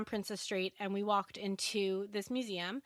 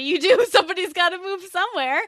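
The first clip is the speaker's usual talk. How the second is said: laughing; 8 semitones higher, 10 dB louder than usual. Real, not acted. Laughing recalling landing in his arms.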